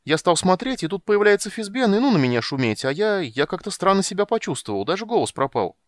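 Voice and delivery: loud, high, bubbling over; breathless boasting about flying; proud, excited kender. A narrator's reading. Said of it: "сказал Кендер гордо".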